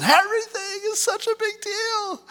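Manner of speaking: high whiny overwrought voice; hammed-up emotional person; mock drama queen. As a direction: whine it like everything is a crisis. Mimicking the overly emotional person.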